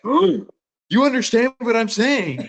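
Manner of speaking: very high, drawn-out disbelief, imitated; amazed drunk guy. Drunk guy to the missionary who answers him, mock amazement. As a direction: imitate a drunk man thrilled to be understood. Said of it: "then the drunk guy was like".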